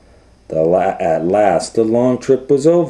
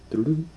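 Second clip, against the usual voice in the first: dial sound; 8 semitones higher, 8 dB quieter than usual. Shown as a demonstration. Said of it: "You had to dial it like this".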